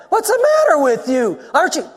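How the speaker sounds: high-pitched badgering yell; mock yelling. Accusers badger Jesus who will not answer, acted yelling. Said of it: "they're yelling at him".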